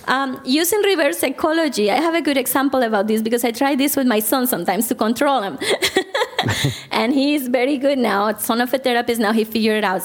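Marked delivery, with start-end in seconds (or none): none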